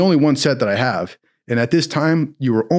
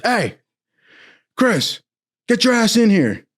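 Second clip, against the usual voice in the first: higher, hard-called summons; barking chief; 9 semitones higher than usual. Retold or imitated. Navy chief calling the recruit into his office, barking. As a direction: call him in like a gruff chief. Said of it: "he goes".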